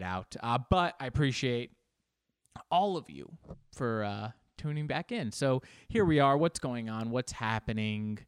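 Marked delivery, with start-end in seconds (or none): none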